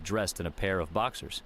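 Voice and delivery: usual speaking voice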